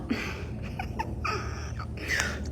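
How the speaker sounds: high breathless laugh; sheepish giggle. Real, not acted. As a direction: burst out laughing at yourself. Confessing the lie.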